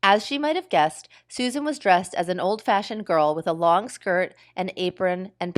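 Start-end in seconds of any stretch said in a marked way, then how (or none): none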